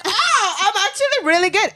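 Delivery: high, quick mock boast through a laugh; mocking imitation. Friend mocking the other's boast, high mocking imitation.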